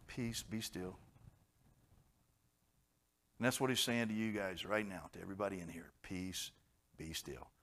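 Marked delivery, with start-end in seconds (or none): 0.1-1.0 calm command. Retold or imitated. Quoting Jesus calming storm. 6.0-7.5 soft, low, gentle; calm command. Retold or imitated. God calming the worried congregation, soft and low.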